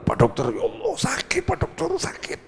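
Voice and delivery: mock complaint of a sick patient; keluhan pasien. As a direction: act out moaning to the doctor. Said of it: "curhat ke dokter". (pura-pura mengeluh kesakitan)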